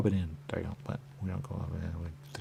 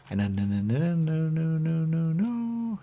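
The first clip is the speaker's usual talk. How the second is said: no's climbing higher and louder; rising no; 10 semitones higher, 8 dB louder, slower than usual. Real, not acted. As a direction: build each no up until it squeaks. Rising string of denials.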